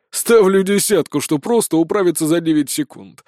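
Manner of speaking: smirk as you wager; smirking sergeant. A narrator's reading. Sergeant bets on the recruit with a smirk. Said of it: "усмехнулся сержант".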